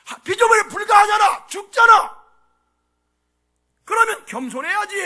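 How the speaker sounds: loud, high sermon cry; full-force preaching. Acted. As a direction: drive every point home from the pulpit. (힘차게 외치는 설교)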